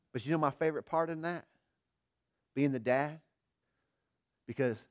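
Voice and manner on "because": usual speaking voice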